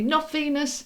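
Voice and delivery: high, sharp emphatic "Not Venus"; relaying a message, high and insistent; emphatic correction. Retold or imitated. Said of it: "they're just saying to me".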